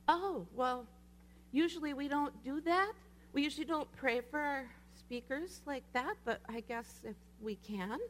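reluctant agreement. Retold or imitated. They answer her request to pray for the speaker, reluctant.

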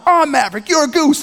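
put-on high, insistent childish comeback; squabbling kid. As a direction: play an older brother pulling rank in a game. Retold brothers squabbling.

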